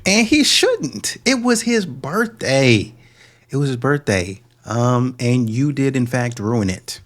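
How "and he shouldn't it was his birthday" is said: high, drawn-out sarcastic outrage; hammed-up scolding, high and slow; mock indignation. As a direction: stretch each phrase in exaggerated indignation. Mock scolding of woman in clip.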